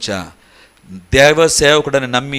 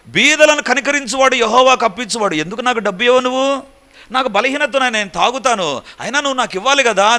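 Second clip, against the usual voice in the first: imitating the drunk man; 9 semitones higher than usual. Drunk man pleading for money.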